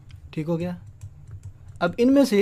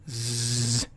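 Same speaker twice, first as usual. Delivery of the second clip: slow soft buzzing زز like a vibrating phone; phone buzz imitation; 5 semitones lower, 5 dB quieter than usual. Shown as a demonstration. Teacher imitates a vibrating phone. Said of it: "phone vibration موڈ پہ ہے".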